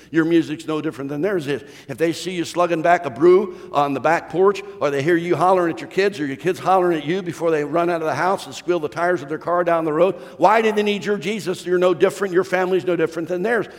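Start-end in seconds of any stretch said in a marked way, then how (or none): none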